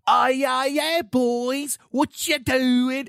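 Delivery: put-on drawn-out kid's puppet show voice, way up high; kid's unicorn puppet voice. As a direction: imitate an eight-year-old doing the annoying unicorn puppet. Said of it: "hearing her eight-year-old voice come down the steps wearing the puppet and going".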